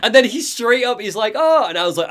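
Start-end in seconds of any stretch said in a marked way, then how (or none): 1.3-1.6 excited. Retold or imitated. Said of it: "he's so excited".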